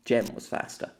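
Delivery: usual speaking voice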